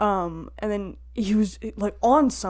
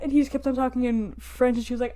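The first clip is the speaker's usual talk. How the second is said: ordinary delivery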